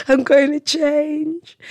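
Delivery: put-on high, drawn-out childish vow; hammed-up tearful promise to God; mock heartfelt prayer. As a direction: plead slowly as a repentant child. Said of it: "pouring my heart out to God".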